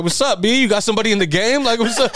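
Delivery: high, pushy call-out to a stranger; mock-tough what's up; mock confrontation. Retelling confronting staring kid.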